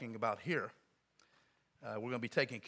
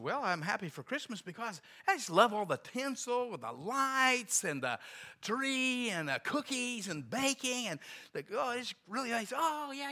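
high, gushing imitation of a woman clerk; hammed-up delight over holiday trimmings; 10 semitones higher than usual. Hotel clerk telling visitor why she loves Christmas, mock gushing.

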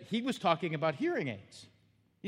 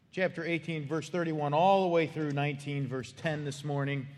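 ordinary talk, nothing marked

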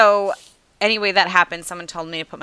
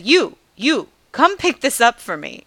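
a raised, lightly voiced bossy old woman; imitating the woman; 5 semitones higher, 5 dB louder than usual. Old woman from her balcony ordering a stranger, lightly mimicked and raised. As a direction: point and order it up the street at her. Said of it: "She pointed at me and she said".

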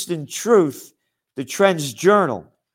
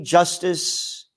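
usual speaking voice

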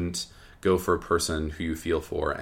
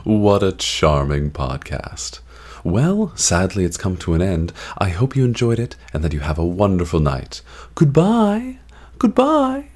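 mock host sign-off; 8 dB louder than usual.

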